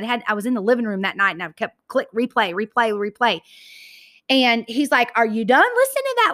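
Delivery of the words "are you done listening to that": lightly imitated husband, pitched much higher; mock-incredulous husband, high-pitched; teasing husband.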